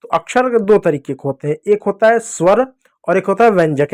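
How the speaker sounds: usual speaking voice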